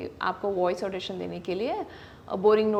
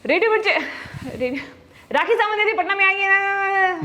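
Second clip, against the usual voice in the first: loud, high, overexcited radio shout; shouting into the mic; 10 semitones higher, 5 dB louder than usual. Retold or imitated. Nervous newcomer yelling her audition script into the mic. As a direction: belt the script out far too loud. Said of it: "आप mic पे चिल्ला रही हैं".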